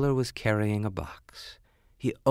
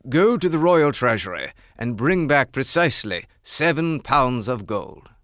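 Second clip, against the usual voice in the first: loud, firm king's order; royal command; 3 semitones higher, 7 dB louder than usual. Acted. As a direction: command the servant. King orders a servant.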